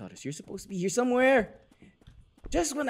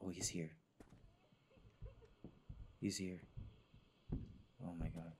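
whispering; 13 semitones lower, 23 dB quieter than usual. Real, not acted. Hiding from a monster.

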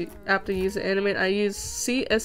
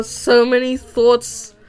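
dramatic declaration; 2 semitones higher, 8 dB louder than usual. Pretend. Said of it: "so many thoughts are in my head".

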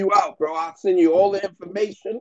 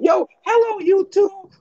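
mocking a bassless YouTuber's hello; voice with no bass; 4 dB louder than usual. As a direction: mimic him, much higher and thin, no bass at all. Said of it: "No bass in his voice".

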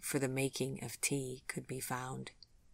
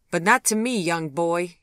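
teasing; 4 semitones higher, 9 dB louder than usual. A narrator's reading. Vivian teases Gregory.

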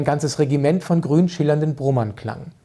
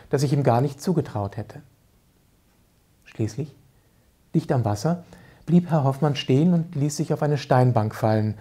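usual speaking voice

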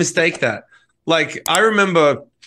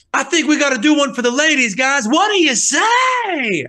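high stage call, stretched at the end; 9 semitones higher than usual. Acted. Frontman picking the show up, hammed-up stage shout. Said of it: "he would always have that same inflection".

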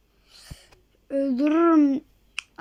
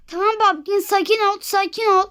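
ordinary talk, nothing marked

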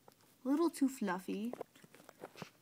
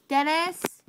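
calling out; 6 semitones higher, 11 dB louder, much slower than usual. Real, not acted. Calling out to someone.

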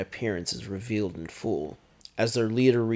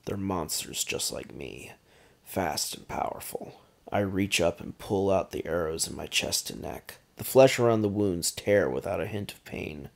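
plain reading voice

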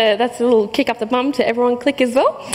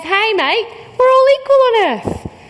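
cheerful call; 9 semitones higher than usual. Pretend. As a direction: proclaim it brightly to everyone. Voicing the turban's message.

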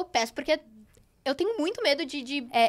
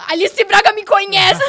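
loud, high, drawn-out laughing cry; hammed-up fangirl yell; starstruck outburst; 6 semitones higher, 12 dB louder, slower than usual. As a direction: exclaim in giddy disbelief. Acts out her amazed reaction.

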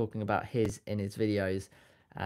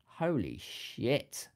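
stunned; 3 semitones higher, much slower than usual. Real, not acted. Shocked at gold price.

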